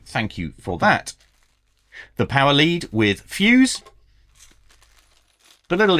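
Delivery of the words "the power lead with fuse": ordinary talk, nothing marked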